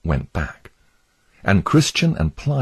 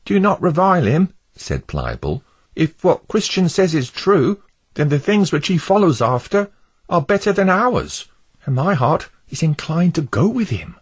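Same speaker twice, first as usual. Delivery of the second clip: higher, gentle hopeful voice; Pliable the wavering neighbour; 12 semitones higher, 3 dB louder than usual. Acted. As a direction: defend the pilgrim warmly.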